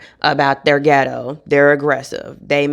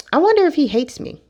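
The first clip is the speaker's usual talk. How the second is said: wondering voice; 11 semitones higher, faster than usual. Retold or imitated. Imagined inner worry quote.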